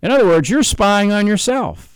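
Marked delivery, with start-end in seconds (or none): none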